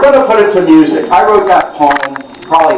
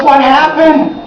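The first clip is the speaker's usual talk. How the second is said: much higher urgent question; alarmed father; 10 semitones higher than usual. Retold or imitated. Father demanding daughter explain, higher. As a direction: press her hard for the answer. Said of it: "He goes".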